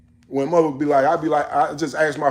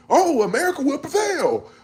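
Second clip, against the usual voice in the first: much higher, sing-song mock optimism; mocking naive quote; 12 semitones higher than usual. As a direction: parody a naive believer. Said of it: "we're not talking about".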